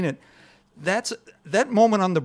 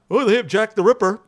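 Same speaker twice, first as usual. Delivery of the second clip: higher, louder mock quip; mocking jokey line; 5 semitones higher, 5 dB louder than usual. Mocking the film's jokey bridge line, hammed-up. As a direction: send up the jokey dialogue. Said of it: "And not".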